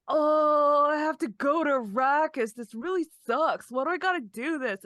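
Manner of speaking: a hint of a whiny teen, groaning about the trip; whiny mopey teen. Podcast host mimics sulky teen Paul, lightly whiny. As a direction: complain like a sulky kid forced to travel.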